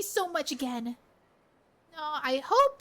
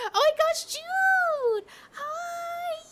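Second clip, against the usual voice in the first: much higher and louder, squealing hello; real excited hello to a friend; excited greeting; 8 dB louder, much slower than usual. Real, not acted. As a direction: burst out with delight.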